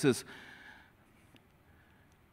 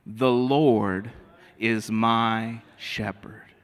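slow recitation; 2 semitones lower, much slower than usual. Shown as a demonstration. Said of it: "Can you say that with me".

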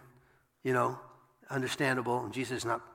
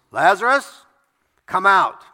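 calling out loud and higher; loud cry; 7 semitones higher, 13 dB louder than usual. Acted. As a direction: shout into the tomb with full force. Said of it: "he cried out with a loud voice".

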